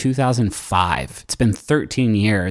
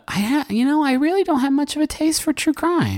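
high mock voice. Feigned high-pitched aside.

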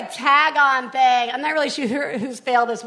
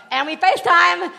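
ordinary talk

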